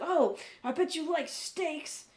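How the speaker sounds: put-on South African guy, accent abandoned. Quoting the South African guy tempting diners, lightly voiced. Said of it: "I can't even do a South African accent".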